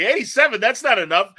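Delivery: mock outrage at his own score; frustrated Boimler. Boimler rejecting his test score, hammed-up indignation. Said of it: "He's like".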